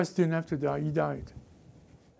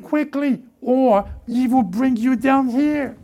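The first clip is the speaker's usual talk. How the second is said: pitched up and pushed, urgent plea; urgent high warning; 6 semitones higher, 6 dB louder than usual. Real, not acted. Preacher urging the church to move quickly across, loud and high. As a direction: press them to hurry across.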